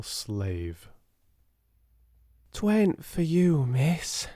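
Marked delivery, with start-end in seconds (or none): 2.5-4.3 hoarse. A narrator's reading. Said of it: "she said hoarsely".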